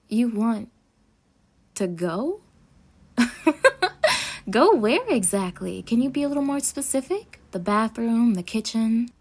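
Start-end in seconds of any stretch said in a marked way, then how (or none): 3.2-4.4 high, loud disbelieving laugh; sharp amused burst of laughter; incredulous laugh. Acted. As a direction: laugh at the absurd request. Captive asks to go, she laughs.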